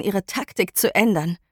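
usual speaking voice

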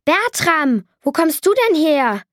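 höher und lauter, überrascht ausgestoßen; plötzlich aufgeschreckt herausplatzen; erschrocken hervorgestoßen. Acted. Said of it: "stieß ich hervor". (erschrockener, lauter Ausruf beim Anblick des Katers)

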